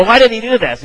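high, abrupt mock demand; blunt, tactless. Questioning the king's officer without any tact, blunt demand. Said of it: "I'm sure he said it with tact".

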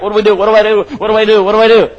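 mock flustered panic. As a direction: act out someone who can't think in a crisis, half-playful. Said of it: "we get so flustered we don't know what to do".

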